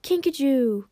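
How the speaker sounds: high, loud, happy cry; delighted greeting. A narrator's reading. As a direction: call back joyfully. Friend answers a delighted greeting.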